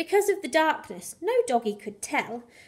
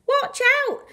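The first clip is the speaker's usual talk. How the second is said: high, louder warning cry; cried out; 12 semitones higher, 5 dB louder than usual. Acted. Clive warns the jiving dogs, crying out. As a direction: shout a quick warning. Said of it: "cried a sensible boxer named Clive".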